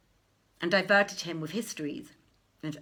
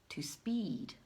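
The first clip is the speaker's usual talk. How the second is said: whispered; 8 dB quieter than usual. Acted. Last word of the poem.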